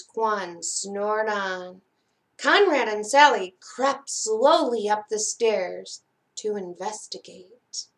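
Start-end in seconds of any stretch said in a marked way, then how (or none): none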